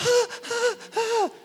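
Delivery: very high, short "ah" yelps; mock startled cries. Acting out the yelled-at listener's reaction, mock startled cries. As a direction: squeal in repeated little shocks.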